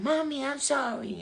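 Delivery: put-on high, slow little-kid apology; sorry child. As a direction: beg forgiveness like a small child. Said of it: "she'd come running".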